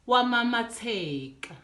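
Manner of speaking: ordinary delivery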